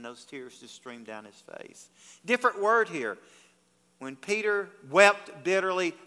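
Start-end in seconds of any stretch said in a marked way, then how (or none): none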